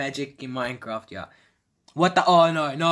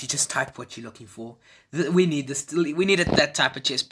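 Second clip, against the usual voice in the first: ordinary delivery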